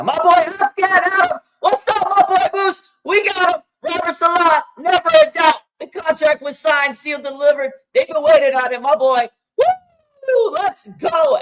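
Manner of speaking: high, loud hype shouting; hyped yelling. Real, not acted. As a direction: scream it like your team just won. Hyped celebration of coach's return.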